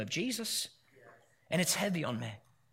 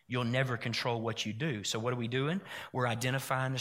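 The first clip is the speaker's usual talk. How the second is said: quiet reflective preaching; 3 semitones lower, 4 dB quieter than usual. Acted. Pastor inviting the congregation to imagine peace, softly. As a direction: draw them in gently, half-hushed.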